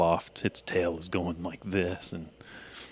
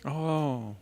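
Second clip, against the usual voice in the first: half sleepy; 7 semitones higher than usual. Acted. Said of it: "she says in a half sleepy voice".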